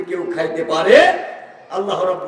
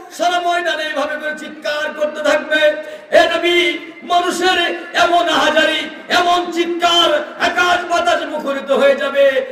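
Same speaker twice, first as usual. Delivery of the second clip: high, wailing sermon chant; fervent preaching; 11 semitones higher than usual. Real, not acted. Preacher describing screams filling the sky, high fervent preaching. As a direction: stretch the cries out high across the crowd.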